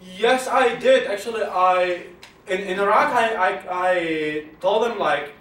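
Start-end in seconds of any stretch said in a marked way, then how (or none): none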